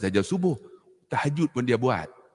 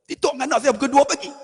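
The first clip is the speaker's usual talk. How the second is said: excited; 11 semitones higher, 5 dB louder, much faster than usual. Real, not acted. Excited recounting midnight TikTok.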